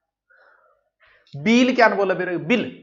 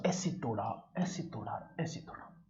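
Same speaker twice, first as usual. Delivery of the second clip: each repeat softer, last one whispered; softer and softer until only breath; fading away; 4 semitones lower, 16 dB quieter than usual. Shown as a demonstration. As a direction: let it die down to a whisper. Breaking the map into pieces.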